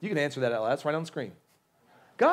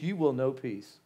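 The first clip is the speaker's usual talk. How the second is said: ordinary talk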